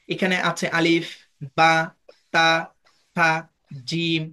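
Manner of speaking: slow careful Arabic letter names; আলিফ বা তা থা জিম spelled out slowly, one by one; Arabic letters demo. Shown as a demonstration. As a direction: pronounce আলিফ, বা, তা, থা, জিম each distinctly by its makhraj. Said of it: "এই যে আমি পাঁচটা লেটার উচ্চারণ করেছি".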